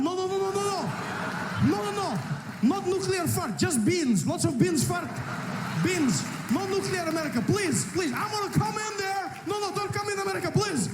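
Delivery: panicked Iran. Pretend. Iran frantically begging America to stay out, high and panicked. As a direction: beg desperately not to be invaded.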